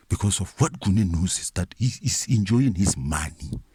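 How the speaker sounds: ordinary talk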